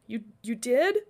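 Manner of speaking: small, teary stammer; holding back tears. A narrator's reading. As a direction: ask it through held-back tears. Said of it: "Glory sniffed back her tears".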